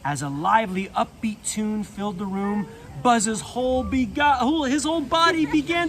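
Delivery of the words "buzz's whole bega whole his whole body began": higher, louder, quivering as if shaking. Pretend. Narrator acting Buzz's shaking body, quivering. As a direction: let the whole body shake the words. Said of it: "He was shaking".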